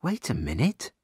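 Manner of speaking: soft, puzzled inner voice; quiet, with a questioning lift at the end; thinking to herself. A narrator's reading. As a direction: murmur it as a dawning thought. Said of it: "thinks Sally".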